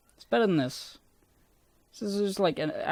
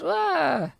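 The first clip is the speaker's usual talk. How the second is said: sudden high shrieked "Ah!"; imitated JonTron scream; 14 semitones higher, 4 dB louder than usual. Mimicking JonTron's comedy scream, high loud yelp. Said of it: "he goes".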